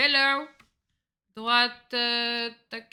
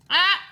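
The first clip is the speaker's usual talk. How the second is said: mock yell; 11 semitones higher, 15 dB louder than usual. Mimics character's yell.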